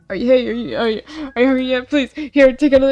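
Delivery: panicky pleading. Acted. Panicking character forcing Elazar to eat, acted freak-out. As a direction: act out frantically pushing food on someone. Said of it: "freaking out and stuff".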